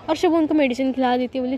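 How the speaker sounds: ordinary talk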